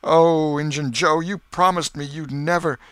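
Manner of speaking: loud, high, panicked appeal; desperate exclamation. A narrator's reading. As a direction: cry out to Joe in despair.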